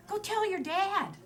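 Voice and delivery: half-joking exasperated mother; exasperated brush-off. Retold or imitated. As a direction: shoo the kid off to his dad. Mom brushes off child's claim.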